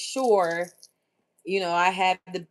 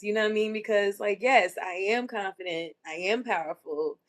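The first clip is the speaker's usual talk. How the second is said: usual speaking voice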